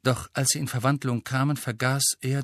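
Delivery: ordinary delivery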